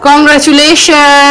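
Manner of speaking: slow stretched mock-grand congratulations; drawn-out congratulations. Real, not acted. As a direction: stretch it out teasingly. Congratulating the winner.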